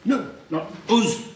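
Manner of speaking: high, loud posh correction in Queen's English; correcting English teacher. Acted. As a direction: reject the Yorkshire "us" as the teacher. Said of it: "They say".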